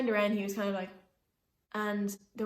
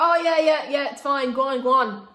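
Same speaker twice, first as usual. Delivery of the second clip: loud, higher, imitated brush-off; called-out reassurance; 5 semitones higher, 12 dB louder than usual. Man telling driver all is fine, loud dismissive call. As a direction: call it down the bus to wave someone away.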